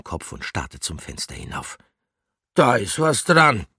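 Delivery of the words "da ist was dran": ordinary delivery, nothing marked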